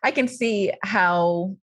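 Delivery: ordinary talk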